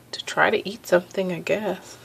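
soft whispered aside; hushed trailing whisper. Real, not acted. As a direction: whisper it like sneaking off to the kitchen. Sneaking off downstairs to eat.

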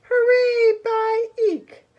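loud, high mouse voice; high-pitched field mouse cry; squeaky mouse. Acted. Field mouse character squeaks song.

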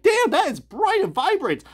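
mock wow at how vivid it is; impressed exclaim. Reviewer gushing to viewers about the vivid orange, amazed. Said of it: "this pops you in the face like".

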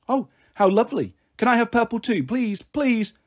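put-on high-pitched little girl, eager and wanting; pleading child. Little sister begging big sister for purple too, pleading. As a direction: plead like an excited small child.

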